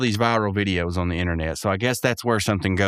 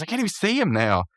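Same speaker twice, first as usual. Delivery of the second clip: high Rigby quote; 9 semitones higher, faster than usual. Retold or imitated. Voicing Rigby.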